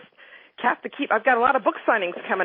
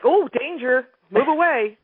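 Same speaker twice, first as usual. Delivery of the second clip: higher, louder playful warning; mock rattlesnake warning; 5 semitones higher, 4 dB louder, slower than usual. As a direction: voice the rattle of a dating partner. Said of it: "you know, like".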